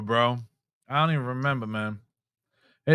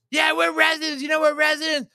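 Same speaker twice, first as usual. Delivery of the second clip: higher, shouting over the driver; mock indignant yell; 10 semitones higher than usual. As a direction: imitate her yelling that they live here. Girl yelling over driver.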